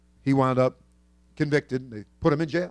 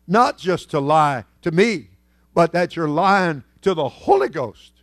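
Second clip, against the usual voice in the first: raised, carrying sermon retelling; preaching; 3 semitones higher, 6 dB louder than usual. Acted. Pastor retelling Ananias story to church, in full preaching voice.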